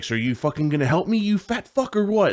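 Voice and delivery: high furious mock yell at the clerk; irate customer yelling insults; angry yell. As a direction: snarl it like an enraged customer. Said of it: "then he gets so mad".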